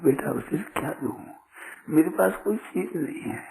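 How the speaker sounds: softly voiced guru to his disciple; soft, fatherly. Ailing guru tenderly addresses disciple. (धीमे, स्नेह भरे गुरु के स्वर में)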